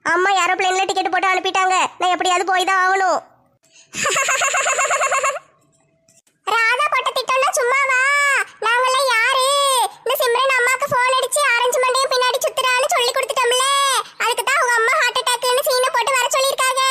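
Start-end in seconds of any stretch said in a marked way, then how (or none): 3.9-5.4 high-pitched scheming laugh; gloating cackle. Acted. Schemer gloats over trick. 6.5-16.8 high-pitched villainess boasting of her plot; scheming woman, gloating. Acted. Schemer gloats over her plan.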